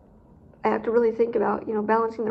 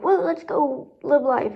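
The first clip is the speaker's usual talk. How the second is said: mock upbeat; 4 semitones higher, slower than usual. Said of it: "I'm just more like".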